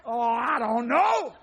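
usual speaking voice

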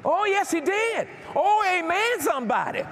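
high, exultant church cry; full-force preaching. Real, not acted. Preacher calling for an amen from the congregation, exultant.

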